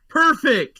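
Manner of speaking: high, loud, triumphant; gleeful exclamation. Acted. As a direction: burst out with mock delight. Player gleefully finishes counting murder targets.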